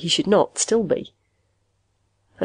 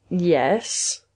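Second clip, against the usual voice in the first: guarded, hesitant reply; wary. A narrator's reading. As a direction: answer cautiously, unsure of the questioner. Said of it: "Magnusson said slowly and warily".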